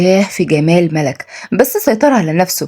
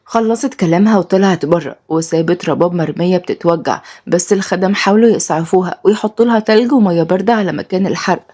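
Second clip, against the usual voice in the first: usual speaking voice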